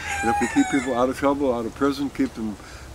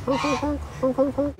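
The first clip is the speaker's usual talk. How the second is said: much higher honking goose calls; goose honks; 13 semitones higher than usual. Retold or imitated. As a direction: honk high like an excited goose. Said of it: "crested Roman goose".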